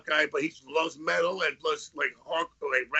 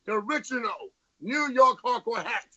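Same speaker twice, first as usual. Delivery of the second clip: upbeat merch plug, slightly raised; ad read; 4 semitones higher than usual. Acted. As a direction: sell it like a radio ad. Plugs merch like an ad.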